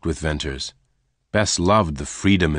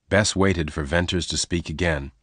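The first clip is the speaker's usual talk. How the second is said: usual speaking voice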